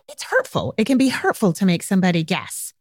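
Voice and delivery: normal talking voice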